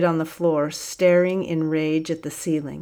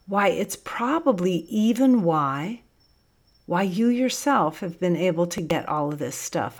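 ordinary delivery

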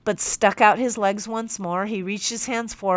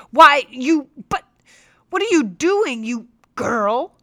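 much higher and louder indignant outburst; outraged shout; 7 semitones higher, 7 dB louder than usual. Acted. Captain furious at his young teacher, high loud outrage. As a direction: explode at the girl who slapped him. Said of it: "red in the face, glaring at me".

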